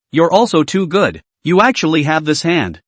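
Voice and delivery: plain reading voice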